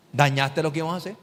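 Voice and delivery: normal talking voice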